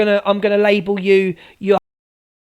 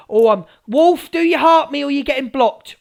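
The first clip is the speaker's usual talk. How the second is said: ordinary talk, nothing marked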